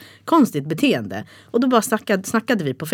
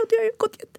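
high, cracking into tears; crying. Retold or imitated. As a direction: voice breaking as she cries. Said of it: "Jag började gråta så mycket".

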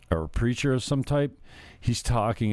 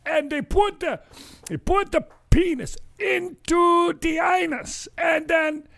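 very high, louder, slow put-on preacher accent; mock African preacher; 5 dB louder than usual. Retelling the Ugandan preacher's sermon in his accent. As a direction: ham up the preacher, shocked and preachy. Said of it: "he's talking about".

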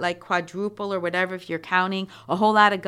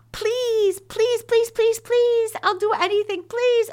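put-on much higher, whiny child pleading please over and over; hammed-up childish wheedling; begging child; 3 dB louder than usual. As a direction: beg like a kid who wants to go to the park. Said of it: "say please and beg and cry".

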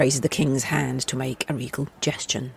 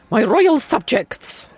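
king voice; 7 semitones higher, 6 dB louder, slower than usual. Acted. Narrator voices the king's speech.

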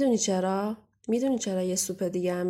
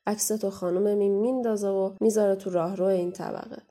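usual speaking voice

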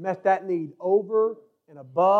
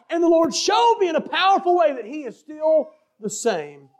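raised preacher's cadence; full-force preaching; 6 semitones higher than usual. Acted. Preacher testifying to the congregation of God's help, full-force preaching. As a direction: testify with high, driving emphasis.

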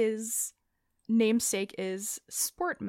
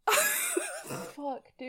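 laughing squeal. Real, not acted. Fit of laughter at pun.

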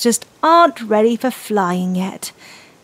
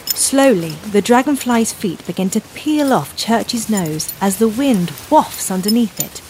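usual speaking voice

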